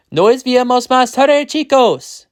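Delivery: high, loud Spanish farewell; calling goodbye. A narrator's reading. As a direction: call out to the kids leaving. Father calls goodbye to kids.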